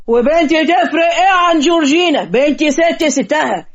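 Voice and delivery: indignant father. Acted. Bride's father comparing his daughter to Georgina, indignant. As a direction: defend your daughter with wounded pride.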